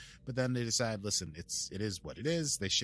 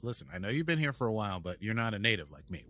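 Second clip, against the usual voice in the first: usual speaking voice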